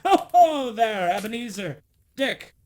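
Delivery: booming, high hearty laugh-call; big jolly merchant calling his lads, laughing; jovial fat voice. A narrator's reading. Old Fezziwig calls his apprentices in a jovial booming voice. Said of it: "called out in a comfortable, oily, rich, fat, jovial voice".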